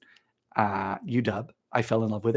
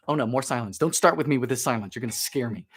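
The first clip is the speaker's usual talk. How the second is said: normal talking voice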